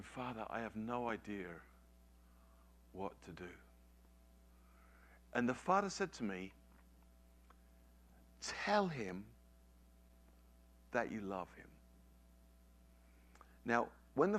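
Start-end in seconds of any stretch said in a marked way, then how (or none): 0.0-3.5 soft, helpless inward plea; hushed prayer. Retold or imitated. Father at a loss praying to God, hushed and helpless. 8.4-11.7 soft, measured gentle instruction; quiet divine prompting. Retold or imitated. God answering a troubled father, soft and deliberate.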